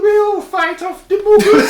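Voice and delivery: shrieking high, loud, drawn-out Scottish falsetto; mock Scottish accent. Kindly couple promising protection, squealed mock Scottish accent. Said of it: "that was in a Scottish accent".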